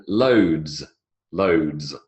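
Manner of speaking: "loads" slow, ending in a clear dz; slow model word. Shown as a demonstration. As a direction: model "loads" twice for repeating. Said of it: "The D sound".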